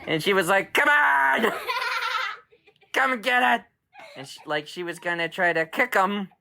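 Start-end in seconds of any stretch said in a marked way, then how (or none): 0.7-3.6 taunting challenge. Acted. Scary Saurus taunting raptors.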